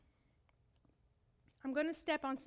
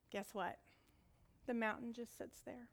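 ordinary talk, nothing marked

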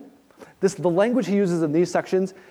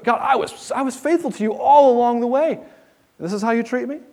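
much higher, pleading and aggrieved; Job's indignant plea; 8 semitones higher than usual. Retold or imitated. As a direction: protest to God as a wronged faithful man. Job disputes with God, voiced.

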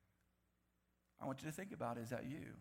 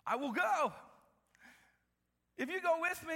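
scared Barak; 11 semitones higher, 6 dB louder than usual. Acted. Preacher voices scared Barak.

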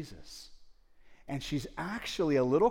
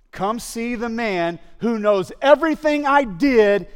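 much higher and louder, slow and calling out; joyful proclamation; 9 semitones higher, 13 dB louder, slower than usual. Acted. Woman telling her people about Jesus, loud joyful call.

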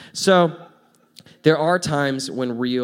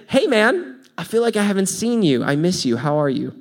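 higher, friendly, open-hearted "Hey, man"; warm greeting; 5 semitones higher than usual. Shown as a demonstration. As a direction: greet him like you missed him. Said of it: "Rather than saying".

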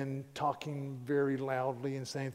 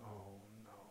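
very soft, low "oh no"; hushed dismay; 5 semitones lower, 20 dB quieter than usual. Retold or imitated. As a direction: sink to a near-silent, dismayed murmur. Husband's dread watching wife confront men.

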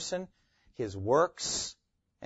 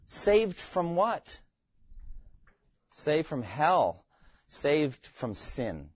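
Saved from what, Saved from hell, Saved from sin, each said slowly; slow, weighted answers; 3 semitones higher, much slower than usual. Shown as a demonstration. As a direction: let each answer land with a pause. Teacher answering his own question slowly.

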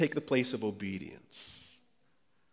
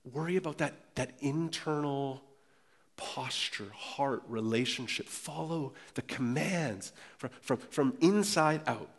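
much softer, leaning in close for the scripture; intimate quiet; 6 dB quieter than usual. Acted. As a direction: draw them in with a hushed, earnest voice. Quoting Jesus and Paul gently.

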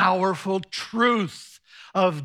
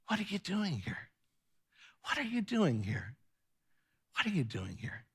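soft repeated asking; 9 dB quieter than usual. Acted. Preacher voicing God's love asking a hurting listener, softly. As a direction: ask it tenderly, as if leaning in close.

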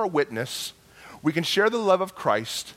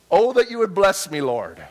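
lifted, louder plea to God; declaimed prayer; 3 semitones higher, 6 dB louder than usual. Acted. Preacher to congregation, reciting Jabez's prayer with a loud, lifted plea. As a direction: proclaim the prayer out to the room.

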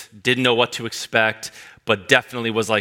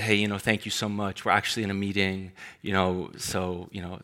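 much softer, kind and polite; gentle, kind; 3 semitones lower, 8 dB quieter than usual. Retold or imitated. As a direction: decline the stranger gently. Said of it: "as gentle, as kind as you could be".

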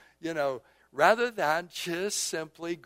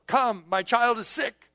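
loud, higher-pitched acted pleading; urgent plea; 7 semitones higher, 6 dB louder than usual. Father telling Jesus his child is sick, urgent plea. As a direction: beg for help with raised voice.

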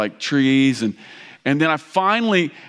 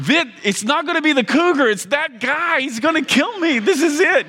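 mock panic; 10 semitones higher than usual. Panicking at the house with gun.

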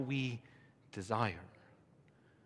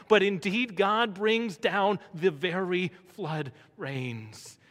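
loud, raised pulpit voice; full-force preaching; 4 semitones higher, 10 dB louder than usual. Real, not acted. Preacher telling the congregation of Elijah's showdown, full-force preaching. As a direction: proclaim it with driving force.

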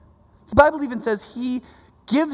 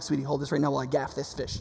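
put-on quick, soft aside, sweet-talking the kid; a hurried hushed "sweetie" while busy; fast quiet wheedle; sly aside to child; 8 semitones lower, 9 dB quieter, faster than usual. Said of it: "be like".

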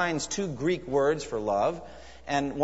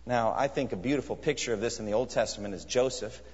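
ordinary talk, nothing marked

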